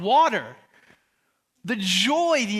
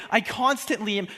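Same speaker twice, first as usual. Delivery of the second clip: normal talking voice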